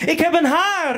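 high, excited call about a first hair; excited cry. Retold or imitated. Boy calls out to the house, high and excited. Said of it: "riep ik".

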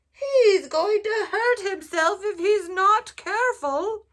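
high, slow fretful voice with a laugh; worried sheep. A narrator's reading. Sheep worrying as duck rides by, high and slow. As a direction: fret over the reckless duck. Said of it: "She's worried".

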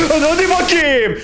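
high, pressing hurry; high keyed-up urging; tense urging. Real, not acted. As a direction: egg the game on.

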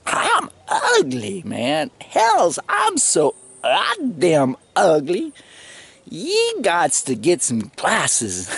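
high, drawn-out, overacted complaint; mock exaggerated protest. Telling the young women he is too ugly, in a high hammed-up protest. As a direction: ham up how ugly he is, slowly.

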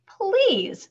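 high, loud protest; indignant plea. A narrator's reading. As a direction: cut in, affronted, on one word. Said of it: "when she had found her voice".

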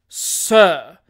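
loud, stern "sir" of outrage; awful voice. Acted. Doctor confronting his rival, awful voice. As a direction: thunder it with offended menace. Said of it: "said the doctor in an awful voice".

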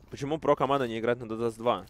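ordinary talk, nothing marked

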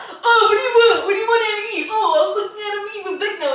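high, loud, laughing parody of his evasive chatter; mocking imitation. Wife mocking her partner for dodging the question, high laughing parody. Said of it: "Yes, you was".

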